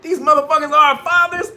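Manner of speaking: very high, loud horrified rant; high hammed-up panicked scream; screaming in shock. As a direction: shriek it in disbelief. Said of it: "He's screaming because".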